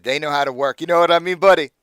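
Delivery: loud, leering buddy tone. Acted. GM voicing Aldo's crude joke.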